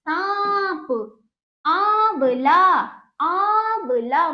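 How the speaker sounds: long nasal आं in सांप and आंवला; slow, emphatic model of सांप, आंवला; open-mouthed nasal demo. Shown as a demonstration. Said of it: "मुंह खोल के नाक से और मुख से".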